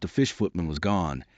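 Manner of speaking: usual speaking voice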